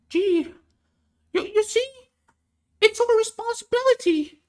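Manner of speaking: a bad, high falsetto take on the professor; Jordan Peterson impression. Mocking Jordan Peterson's take on borders, squeaky high impression. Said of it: "very bad Jordan Peterson accent".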